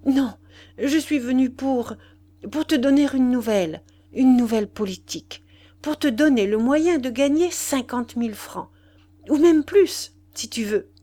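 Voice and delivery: high, forced firmness with broken pauses; pained, halting voice. A narrator's reading. Mistress holding back her departing lover with news, pained and halting. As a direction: push the words out through held-back tears. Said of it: "interrompue par un chevrotement de douleur".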